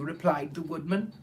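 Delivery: usual speaking voice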